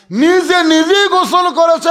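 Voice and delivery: loud, high-pitched repeat; emphatic preaching cry. A narrator's reading. Preacher repeats for the congregation that she bathed herself, emphatic cry. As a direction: proclaim it at full force, high and loud.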